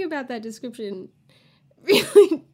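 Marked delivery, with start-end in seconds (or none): 1.5-2.4 laughing. Real, not acted. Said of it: "I'm not sure why I'm laughing".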